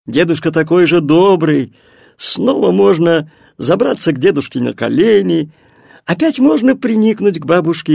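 ordinary delivery, nothing marked

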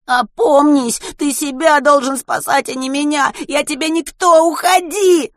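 desperate breaking cry; voice breaking shout. A narrator's reading. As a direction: scream it as the voice cracks. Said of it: "срывающимся голосом".

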